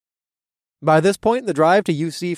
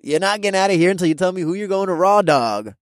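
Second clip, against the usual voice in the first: frat bro voice; 6 semitones higher, 4 dB louder than usual. A narrator's reading. Toby voice.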